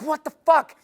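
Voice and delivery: sudden high, loud "what the fuck"; mock outrage. Girl baffled he won't validate her, loud mock outrage. Girl's confused inner voice.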